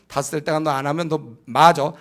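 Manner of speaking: normal talking voice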